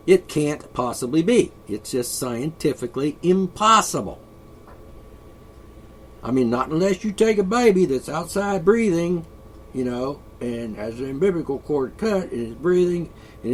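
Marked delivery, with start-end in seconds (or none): none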